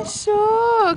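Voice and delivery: high, loud cry of discovery; excited find. Real, not acted. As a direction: cheer it like you just found it. Found the toddler's little spot.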